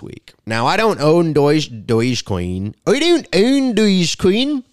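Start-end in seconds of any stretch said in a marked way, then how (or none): 2.9-4.6 squeaky, high-pitched denial; higher put-on voice insisting; high mock denial. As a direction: protest it like a guilty kid.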